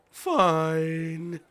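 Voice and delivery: loud, high, drawn-out fed-up cry; loudly fed up; exasperated surrender. Real, not acted. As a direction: give in with a frustrated yell. Giving in after frustration.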